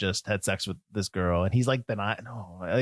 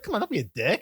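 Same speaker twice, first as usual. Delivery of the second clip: mock jock; 8 semitones higher than usual. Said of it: "That guy's like".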